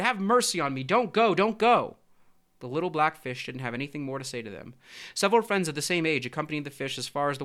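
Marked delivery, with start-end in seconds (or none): 0.0-2.0 higher, louder anguished plea; pleading cry. A narrator's reading. Mother begging her little fish not to leave, pleading cry. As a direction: beg in a raised, urgent voice. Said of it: "The mother cried".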